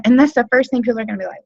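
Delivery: ordinary talk, nothing marked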